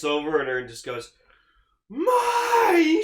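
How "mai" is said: high, loud startled yell of her name; shouted name. Retold or imitated. Hibiki yells at Mai.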